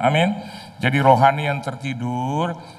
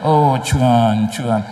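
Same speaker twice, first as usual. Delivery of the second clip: mock sighing prayer; 4 dB louder, slower than usual. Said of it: "kok Tuhan?".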